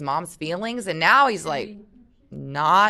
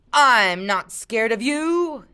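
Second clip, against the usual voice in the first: slow, higher mocking taunt; 6 semitones higher, 5 dB louder, much slower than usual. A narrator's reading. Mark taunts Luke before biting the bread, mock-brave. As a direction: dare him, draw it out. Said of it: "he taunted".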